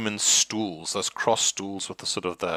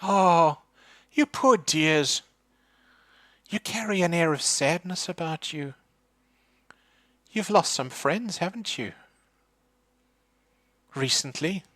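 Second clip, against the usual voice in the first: put-on pitying old lady; 9 semitones higher than usual. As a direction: pity them like a knowing psychic. Psychic pities the party.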